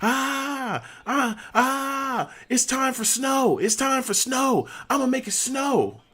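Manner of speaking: put-on high wailing drunk voice, dragged out; mock drunk scream. Roommate imitating Murphy screaming in the hallway, high wail. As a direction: howl it like a drunk gone mad. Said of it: "he just starts screaming".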